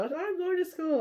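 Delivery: put-on high, chirpy child's voice; little kid voice. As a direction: sound like a proud little girl. Retells the kid again.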